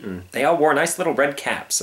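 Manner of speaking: usual speaking voice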